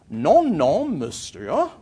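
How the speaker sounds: put-on proud, high English-accented French; schoolboy French. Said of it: "in my best schoolboy French".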